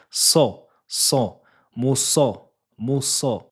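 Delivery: teacher drilling the emphatic syllable. Shown as a demonstration. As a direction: mo so said thick from the back of the tongue. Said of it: "avec une fatha".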